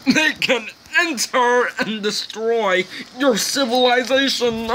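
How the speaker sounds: squeaky high laughing through the words; cracking up mid-sentence. Real, not acted. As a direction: giggle it out, barely holding it together. Laughing at his own joke.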